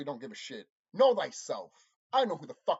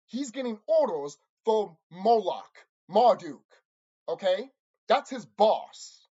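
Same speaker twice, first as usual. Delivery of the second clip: angry emphasis; 7 dB louder, slower than usual. Real, not acted. Angry rant at viewers.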